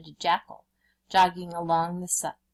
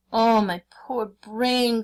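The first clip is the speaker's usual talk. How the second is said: slow, drawn-out lament from a jackal; mock anguished cry; 4 semitones higher, 6 dB louder, slower than usual. A narrator's reading. Jackal hamming up despair to the Brahmin, drawn-out cry. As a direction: wail about his poor brain. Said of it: "cried the jackal".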